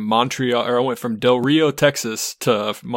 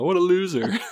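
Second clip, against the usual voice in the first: mock taunt; 8 semitones higher, 3 dB quieter than usual. Mocking the slow plane.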